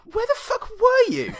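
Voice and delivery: squeaky high-pitched voice; high squeaky mock yell. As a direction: yelp it up high in falsetto. Voicing Shaq's high outburst.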